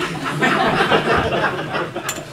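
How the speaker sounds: a long airy laugh, loud and high; breathy laugh. Real, not acted. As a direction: laugh at his own joke about the stop sign. Laughs about his address directions.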